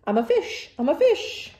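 loud, high, happy splashing voice; delighted pigeon. Acted. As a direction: sing and play in the tub like a child. Pigeon playing in the bath.

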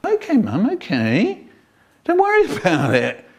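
put-on child reassuring mum; placating child. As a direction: soothe mum and wave it away.

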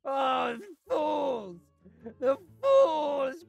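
high, drawn-out scornful glee; villainous gloating. Acted. Gloating over customers who paid anyway, gleeful scorn.

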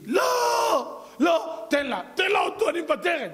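high, loud desperate cry; real mother screams. Retold or imitated. Real mother cries out to the king to spare her son, loud and high. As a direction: scream to save the child. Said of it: "האמא צועקת".

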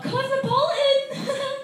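high, slow camp call of the drink; flamboyant order. Retold or imitated. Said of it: "he went up".

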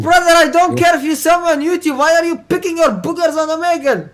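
high-pitched, exasperated telling-off. Real, not acted. Streamer to nose-picking fan, exasperated scolding. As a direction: cut him off with a high outraged rant.

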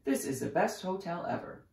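put-on excited child's exclamation; crying out. A narrator's reading. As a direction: gush like a thrilled guest. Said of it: "cried Francine".

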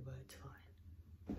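whispered resigned aside; whispering. Real, not acted. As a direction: breathe it out almost silently. Whispered aside, then loud repeat.

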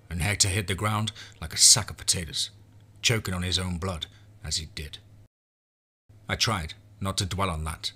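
usual speaking voice

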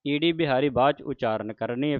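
normal talking voice

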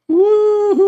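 high, loud party whoop; shrieking woohoo; celebration whoop. Real, not acted. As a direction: cheer like you just hit the jackpot. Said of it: "Okay, enough celebration".

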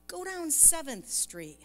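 mildly urgent command, high and drawn out; a little urgency. Retold or imitated. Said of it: "there's a little urgency now".

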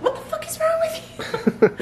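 squeaky imitation of an exasperated husband; imitating husband. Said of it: "and you were just like".

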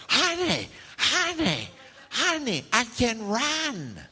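high, slow giddy calling; ecstatic wife. Retold or imitated. Healed wife calling her husband, high slow giddy call. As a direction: call to him overjoyed and high. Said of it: "she was like".

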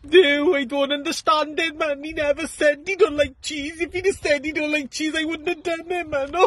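high falsetto Welsh widow; Welsh wife. Retold or imitated. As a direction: play the puzzled Welsh wife in a squeaky falsetto. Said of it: "The Welsh woman says".

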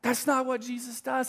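ordinary talk, nothing marked

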